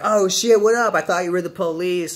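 high, loud relieved greeting; shouting hello, relieved it's no cop; surprised relief. Retold or imitated. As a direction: burst out in shocked relief. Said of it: "I was like".

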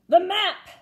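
loud urgent call; urgent pirate. A narrator's reading. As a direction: demand the map. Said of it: "said Braidbeard".

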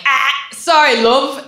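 a breezy London "sorry, love"; British apology. Retold or imitated. London cop and suspect apologize in cheery British accent. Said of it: "in unison, they were like".

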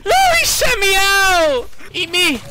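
high, loud, drawn-out cry; panicked wail. Real, not acted. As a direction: wail in real alarm at the game. Panicking at game event.

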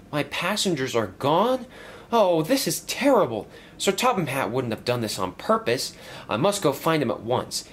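put-on young engine voice.

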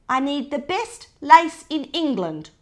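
loud commanding order. Acted. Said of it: "ordered Gran".

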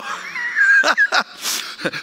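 high-pitched fit of real laughter; helpless laugh. Real, not acted. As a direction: break up laughing mid-sentence. Breaks up while resuming talk.

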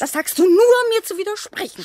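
rising to a sharp, loud indignant cry; indignant outburst. Acted. Wife snapping back at her husband in their quarrel, indignant. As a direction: flare up at him, stung. Bickering couple, she flares up.

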